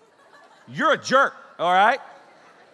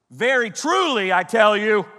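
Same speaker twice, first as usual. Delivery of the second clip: loud, high, drawn-out exasperation; raised voice, losing patience; ticked-off Jesus; 4 semitones higher, 7 dB louder than usual. Acted. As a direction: lay it down slowly and forcefully, fed up. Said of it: "Jesus at times would get so ticked".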